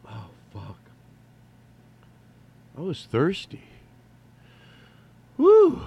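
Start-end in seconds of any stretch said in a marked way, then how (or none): 5.4-5.8 high, loud breathless whoop. Real, not acted. Out of breath after hurrying back, a real whoop. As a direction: let out a big real woo.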